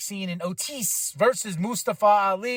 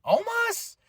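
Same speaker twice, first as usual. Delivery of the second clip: incredulous question leaping very high; baffled, can't believe the name; retelling the viewers' shocked reaction; stunned disbelief. Retold or imitated. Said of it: "we all was like".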